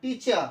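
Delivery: টিচার as "teecha", no finished r at the end; half-said final r. Shown as a demonstration. Teacher modelling the correct form for learners, final r dropped. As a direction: end টিচার on an open "cha". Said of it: "কিন্তু শেষ হবে না".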